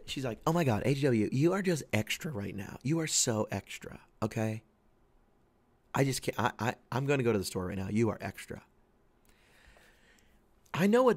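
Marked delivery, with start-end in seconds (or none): 0.4-8.6 imitating fed-up wife. Wife scolding husband about diet, mock exasperated. Wife calls him extra.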